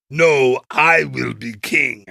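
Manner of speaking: bad British accent, slow and emphatic; hammed-up British villain, drawn out; Jeremy Irons. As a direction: do a terrible British accent, grandly. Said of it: "That's my British Jeremy Irons accent".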